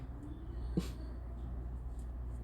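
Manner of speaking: soft unvoiced disbelieving laugh; airy laugh under the breath; breathy laugh. Real, not acted. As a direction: exhale a quiet stunned laugh.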